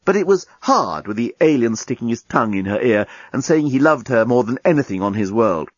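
ordinary delivery, nothing marked

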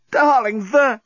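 high, loud emotional cry; tearful cry. A narrator's reading. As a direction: cry out to your beloved, overcome. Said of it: "cried Lucy, tears in her eyes".

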